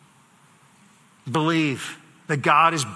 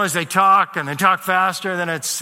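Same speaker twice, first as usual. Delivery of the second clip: normal talking voice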